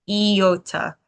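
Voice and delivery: iota with an "ee" start, "ee-OH-tuh". Shown as a demonstration. Teacher contrasting iota with Yoda, "ee-OH-tuh". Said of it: "the reason I say it like that".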